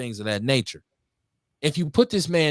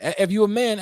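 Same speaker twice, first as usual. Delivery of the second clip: usual speaking voice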